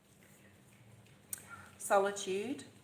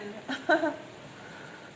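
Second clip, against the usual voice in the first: short laugh; 8 semitones higher, 3 dB louder than usual. Real, not acted. Rain starts during the reading.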